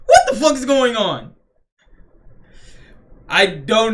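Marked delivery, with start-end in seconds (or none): 0.1-1.3 high, loud baffled outburst; mock confusion. Voicing Luffy seeing the explosions, loud baffled outburst. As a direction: blurt it like a stunned hero. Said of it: "Even Luffy's like".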